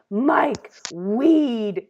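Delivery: loud, isolated words, retold; mock-helpless blurting, word by word; forcing words out. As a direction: imitate barely getting each word out. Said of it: "all I could get out to him".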